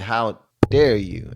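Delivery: slow, with "dare" set apart; pause, then land hard on "dare"; imitated indignant stress. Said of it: "that, that sound made that".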